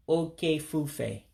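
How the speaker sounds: "Oke fufe" said slowly as oh-keh foo-feh, falling at the end; Igbo pronunciation. Shown as a demonstration. Teacher models "Oke fufe" for hurricane, careful Igbo pronunciation. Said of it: "you would pronounce it".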